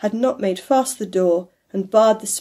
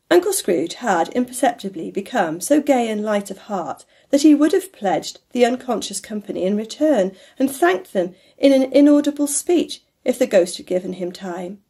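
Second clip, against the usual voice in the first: plain reading voice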